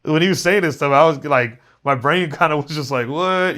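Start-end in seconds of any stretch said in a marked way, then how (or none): 3.1-3.6 re-enacted baffled "what", pitched up; rattled. Student retelling his reaction to teacher's words, rattled. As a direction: act out a brain jolted by surprise. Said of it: "being rattled".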